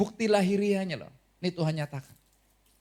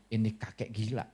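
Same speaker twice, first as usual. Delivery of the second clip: mocking scorn; 7 semitones lower, 4 dB quieter than usual. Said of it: "mereka cuman bilang".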